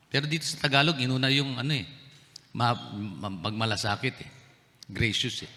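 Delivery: ordinary delivery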